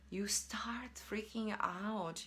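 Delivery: slow, breathy whisper of panic; a slow dramatic whisper. Shown as a demonstration. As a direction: hush it as if the fear creeps in.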